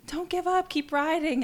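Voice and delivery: high, bright mock encouragement from passing riders; cheering on. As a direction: imitate cheery strangers urging her on. Strangers cheering her on.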